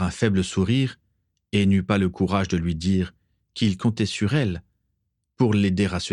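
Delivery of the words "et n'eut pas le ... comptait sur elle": ordinary delivery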